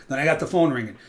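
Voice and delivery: normal talking voice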